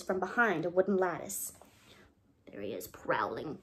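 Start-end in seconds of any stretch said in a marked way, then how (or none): 2.5-3.6 much lower and softer, a lurking growl; a sinister low voice tracking the cat; deep menacing aside. Acted. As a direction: drop low and darkly whisper it.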